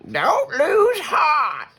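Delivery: high, slow, loud rallying cry; encouraging grandma. Acted. Grandma Poss rallies disappointed Hush, high slow encouraging shout. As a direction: lift her spirits, drawn out.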